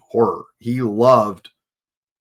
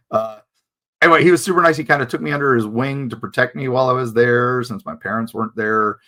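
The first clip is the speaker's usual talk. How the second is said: normal talking voice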